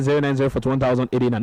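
quick repeat. Shown as a demonstration. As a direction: rattle the number off again quickly.

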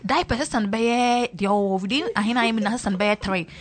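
ordinary delivery

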